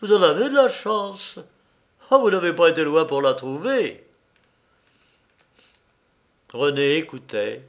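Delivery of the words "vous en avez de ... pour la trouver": higher, livelier dialogue voice; lightly voiced chatty character. A narrator's reading. Someone congratulating the boss on her new maid, lightly acted chatty voice. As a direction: pitch it up a little, addressing the boss.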